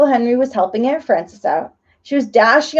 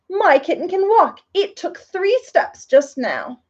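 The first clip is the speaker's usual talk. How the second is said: put-on excited child; 6 semitones higher, slower than usual. A narrator's reading. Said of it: "capitalized excitement".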